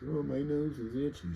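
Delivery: high, soft whiny mock complaint; mocking whine. Streamer mocking his own allergy complaint, high whiny voice. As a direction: whine it like a baby. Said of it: "boo-hoo".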